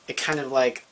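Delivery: ordinary talk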